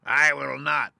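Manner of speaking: loud, high cackling witch refusal; spiteful witch retort. Acted. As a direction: snap back as the wicked witch. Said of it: "retorted the witch".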